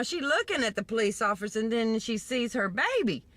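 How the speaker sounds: plain reading voice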